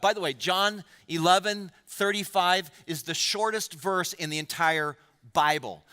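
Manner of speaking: ordinary talk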